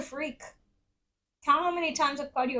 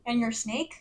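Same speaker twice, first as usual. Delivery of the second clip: usual speaking voice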